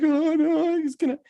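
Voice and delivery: very high imitation of a panicked child; mock freak-out. Boy refuses to touch the banana with the ant, high mock panic. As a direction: squeal the refusal like a terrified kid. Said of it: "You're freaking out".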